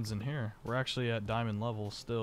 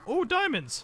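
leaps up high and loud at finding diamonds; real thrill bursting out; excited shout; 7 dB louder than usual. Real, not acted. Finds diamonds after long search.

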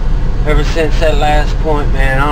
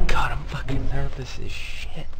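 whispered, tense confession; hushed scared whisper; nervous whisper; 10 dB quieter than usual. Real, not acted. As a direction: breathe it out scared.

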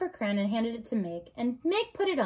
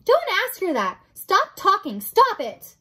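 high, loud, urgent protest; frantic command; 9 semitones higher, 9 dB louder than usual. Acted. Rat tries to stop the princess asking, frantic loud order. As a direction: cut her off in alarm.

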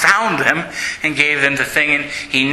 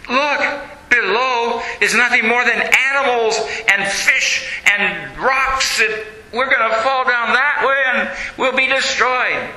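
higher, nervous protest with a laugh; frightened; 7 semitones higher than usual. Acted. Brother Angelo balking at the broken bridge, higher nervous fear.

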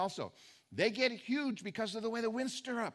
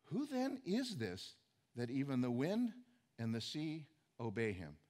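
awed hush; 7 semitones lower, 6 dB quieter than usual. Retold or imitated. Awed disciples speak to each other.

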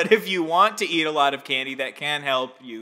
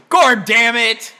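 loud, slow, higher frustrated curse; frustrated outburst; 8 semitones higher, 11 dB louder, slower than usual. Acted. Frustrated after failed scare.

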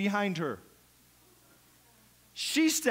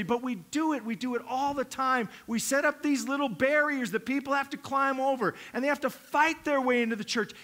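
ordinary delivery

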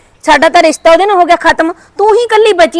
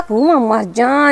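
shocked exclamation; 5 semitones lower, 6 dB quieter, much slower than usual. Acted. Shocked by aunt's news.

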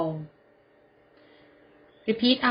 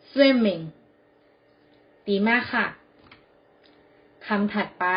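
normal talking voice

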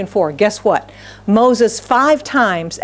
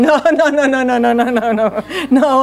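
comic refusal; 3 semitones higher than usual. Acted. Acting out refusing God comically.

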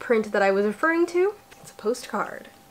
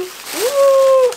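a long ooh, much higher and louder; delighted ooh; 8 dB louder than usual. Real, not acted. Finding a new shirt in the box, excited ooh. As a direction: coo over the new shirt.

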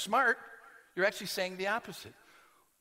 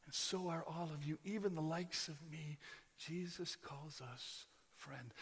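hushed and tender; 4 semitones lower, 12 dB quieter than usual. Real, not acted. Preacher softly telling the congregation they are friends. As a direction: lean in and speak gently. Intimate hushed address to listeners.

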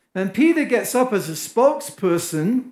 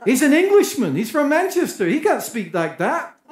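higher, amazed disbelief; astonished listener; 6 semitones higher than usual. Pretend. French listener marvels at the Englishman's perfect French, amazed.

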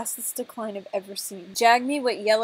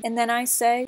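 normal talking voice

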